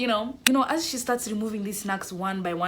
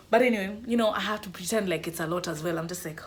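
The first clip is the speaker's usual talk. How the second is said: normal talking voice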